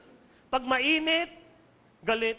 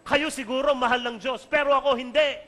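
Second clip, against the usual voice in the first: loud, raised-pitch student outburst; tearful anger, imitated; 5 semitones higher, 8 dB louder than usual. Female student standing up to her teacher, near tears and angry. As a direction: act out an upset student's angry protest. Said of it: "maiyak-iyak at galit".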